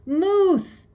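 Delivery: loud, high holler; alarmed shout of a name; calling out. A narrator's reading. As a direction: call urgently across a distance. Dad calls out to running boy.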